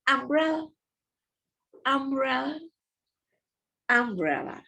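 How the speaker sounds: umbrella said high and brisk, a short u; short vowel demo. Shown as a demonstration. Teacher showing pupils the short u in umbrella. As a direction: clip the u of umbrella. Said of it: "And the short is".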